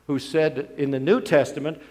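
usual speaking voice

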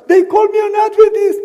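put-on high, loud whining boy; upset child. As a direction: wail it like a hurt kid. Child answering father.

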